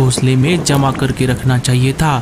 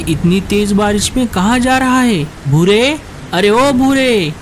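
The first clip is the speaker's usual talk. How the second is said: calling out; 8 semitones higher than usual. Acted. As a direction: shout his name after him. Calling to Bhura in rain. (बारिश में दूर तक ऊँची, खिंची हुई पुकार)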